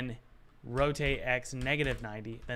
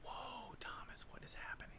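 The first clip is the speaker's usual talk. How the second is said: whisper; 15 dB quieter than usual. Real, not acted. Baffled by rotation settings.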